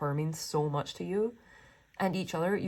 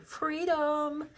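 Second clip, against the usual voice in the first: high, thrilled shout of the word; excited cry; 10 semitones higher than usual. Real, not acted. Tarot reader cheering the couple on, excited. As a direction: burst out with delight. Joyful exclamation reading the cards.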